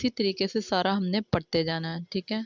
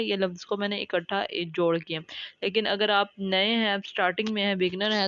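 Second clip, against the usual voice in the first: normal talking voice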